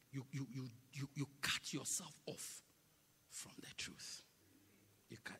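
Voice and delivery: soft, low, ending in a whisper; whispered warning. Acted. Preacher whispering to the church about the truth.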